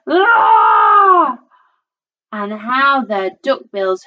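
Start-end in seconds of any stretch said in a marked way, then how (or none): 0.1-1.4 high, loud, long scream; storybook shriek of fright; terrified scream. A narrator's reading. Dad jumps into the air at the sight, terrified scream.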